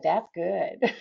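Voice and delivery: quoting through a laugh. Retold or imitated. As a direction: say it while laughing. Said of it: "And she said".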